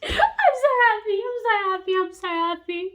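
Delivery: high squeal easing down with each repeat; giddy delight. Real, not acted. Reader delights in jealous possessive hero, giddy squeal. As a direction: gush it over and over, giddy.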